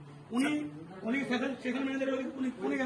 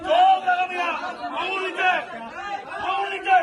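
high, insistent raised voice; real heated challenge, pitched up; angry order; 5 semitones higher than usual. Real, not acted. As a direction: fire short commands at an opponent. Ordering clerk to lower finger.

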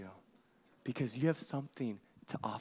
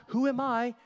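loud mock question; 9 semitones higher, 7 dB louder than usual. Shown as a demonstration. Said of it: "It's not".

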